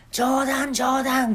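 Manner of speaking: high, loud eager boss voice; a showman giving orders; eccentric shop owner. Retold or imitated. (店の大将が客に猿になる手順を指示する、高く大きな声)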